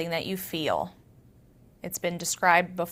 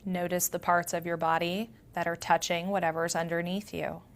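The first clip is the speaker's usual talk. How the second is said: soft meditation voice with long pauses; guided meditation; 4 dB quieter than usual. Acted. Yoga teacher grounding listeners through their senses, guided meditation. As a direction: guide them gently inward, leaving space between phrases.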